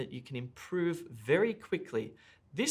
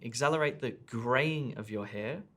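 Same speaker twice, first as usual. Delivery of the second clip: "graying" raised and lengthened within the phrase; stress on GRAYING. Shown as a demonstration. Teacher shows test takers the emphasised keyword, stress on "graying". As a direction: lean into "graying", lighter on the words around it. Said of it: "I've emphasized the word graying".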